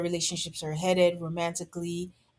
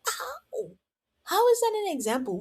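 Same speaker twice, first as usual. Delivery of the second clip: much higher, fast disbelieving squeak; incredulous; 14 semitones higher than usual. Retold or imitated. Host mocks Courtney's pool example, squeaking high. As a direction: let the disbelief run away with you. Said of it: "And I'm like".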